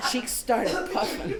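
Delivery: usual speaking voice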